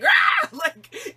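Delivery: high shouted rah; mock demon roar. Describing her temper, a mock demon roar. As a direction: let the inner demon roar. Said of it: "demon triggers".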